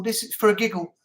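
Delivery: usual speaking voice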